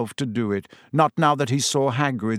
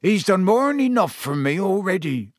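higher, heavy miserable lament; a weary giant's complaint; a touch of a groan, lightly voiced Hagrid; 6 semitones higher than usual. A narrator's reading. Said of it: "groaned Hagrid".

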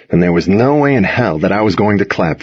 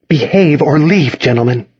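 snarled; 7 semitones higher than usual. A narrator's reading. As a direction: scold through a controlled snarl. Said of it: "she snarled".

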